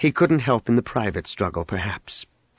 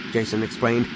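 plain reading voice

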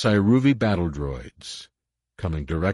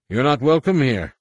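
higher, louder voice over a crackling comm; crackly comlink voice; 8 semitones higher, 7 dB louder than usual. A narrator's reading. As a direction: hostile stranger through a crackly channel. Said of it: "A new voice crackled over the restricted comlink".

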